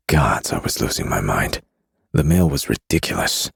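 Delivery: plain reading voice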